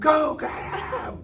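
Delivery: high, stretched-out bratty taunt; defiant kid yell. Retold or imitated. Bratty kid daring mom to get dad, defiant yell. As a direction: dare mom with a drawn-out holler.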